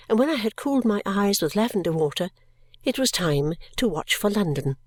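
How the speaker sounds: ordinary delivery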